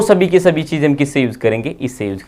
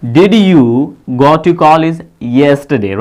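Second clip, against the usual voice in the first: slow model sentence; much slower than usual. Shown as a demonstration. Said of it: "क्या तुम कल college गए".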